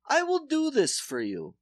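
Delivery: put-on solemn promise in Polish accent. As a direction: dentist pledging the root canal in accent. Dentist voiced.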